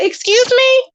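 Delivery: outraged disbelief at the comeback; shocked "excuse me". Retold or imitated. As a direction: react like you can't believe your ears. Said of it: "I said".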